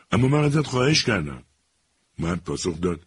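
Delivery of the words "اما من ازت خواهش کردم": protesting. A narrator's reading. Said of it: "جوان اعتراض کرد".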